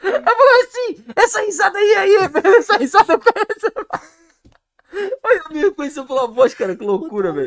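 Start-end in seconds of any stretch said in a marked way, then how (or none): 1.1-3.7 high, loud quote broken by laughter; laughing mimicry. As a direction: laugh while mimicking her excited recognition. Acting out woman recognising his laugh. (imitando entre risadas)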